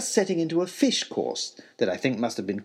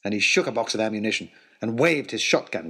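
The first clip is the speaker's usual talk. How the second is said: plain reading voice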